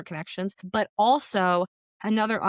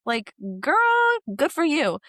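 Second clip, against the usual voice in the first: hyped up cheering; 7 semitones higher, 4 dB louder, slower than usual. Real, not acted. Cheering a contestant for her frank talk, hyped and high.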